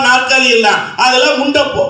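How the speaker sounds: ordinary talk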